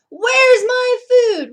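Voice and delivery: very high, very loud, slow roar; Starvin' Marvin roaring at the crowd. Acted. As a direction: bellow at the frog. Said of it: "roared Starvin' Marvin".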